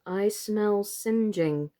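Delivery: slow, sniffing the air. Acted. Charlotte smelling burning, slow and sniffing. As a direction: draw it out as you sniff.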